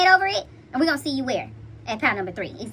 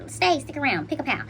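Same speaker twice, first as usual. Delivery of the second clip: ordinary delivery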